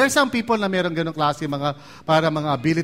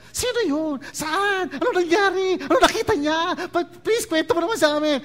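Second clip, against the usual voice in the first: much higher, excited pleading for the story; eager begging; 10 semitones higher than usual. Retold or imitated. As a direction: play curious listeners who can't wait to hear. (sabik at mataas na boses ng nakikiusap na tagapakinig)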